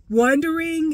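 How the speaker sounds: Wondering said high and loud; stress on wondering. Shown as a demonstration. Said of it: "instead of wondering".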